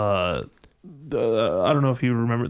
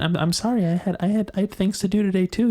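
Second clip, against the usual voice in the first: high, pleading wife; whiny wife voice; 8 semitones higher than usual. Acted. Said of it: "your wife".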